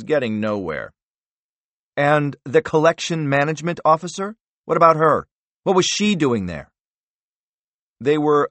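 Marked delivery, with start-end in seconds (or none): 2.0-6.7 higher and louder, insistent; pressing demand. Acted. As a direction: push for the answer.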